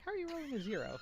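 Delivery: high-pitched incredulous quote; mock disbelief. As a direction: put on a high, baffled voice. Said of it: "He's like".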